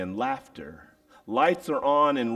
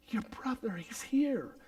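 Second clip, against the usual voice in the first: whispered secret; 11 semitones higher, 9 dB quieter than usual. Acted. Father to elder son, whispering the news. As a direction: whisper it high and soft.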